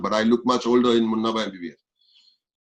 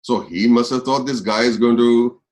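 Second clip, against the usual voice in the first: ordinary talk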